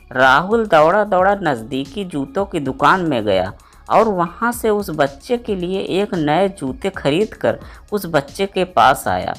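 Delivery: ordinary delivery, nothing marked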